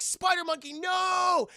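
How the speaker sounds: high, loud cry of alarm; hammed-up horrified yell; dramatic shout. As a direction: yell it out in protest, stretching the end. Acts Ricky Tan crying out.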